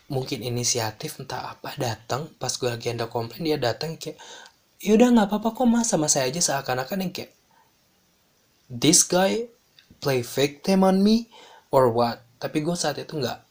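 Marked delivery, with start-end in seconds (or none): 4.8-6.4 high, loud, quick mock helpfulness; imitated obliging boss. Boss to complaining guest, taking over, mock obliging. 8.7-12.2 loud, incredulous aside; mock indignation. Employee reacting to boss who steps in, mock indignant.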